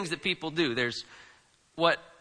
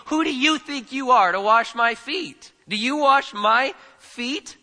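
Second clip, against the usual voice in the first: raised pitch, heavy stress on "you" and "my"; emphatic challenge; 5 semitones higher, 4 dB louder than usual. Acted. Peter protesting to one so much greater, emphatic high stress on "you" and "my". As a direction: challenge hard, slowing down on "Do you wash my feet?". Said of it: "You and my are emphatic".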